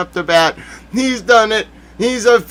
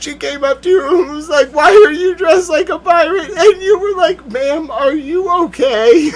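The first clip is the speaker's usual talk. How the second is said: very high, squeaky suppressed laughter; cracking up; 9 semitones higher than usual. Real, not acted. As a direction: push the words out through rising laughter. Cracking up at imagined interview.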